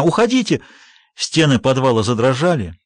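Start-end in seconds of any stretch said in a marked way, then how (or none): 0.0-0.6 repeated shout, falling at the end. A narrator's reading. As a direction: cry out again to get out. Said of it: "закричала она".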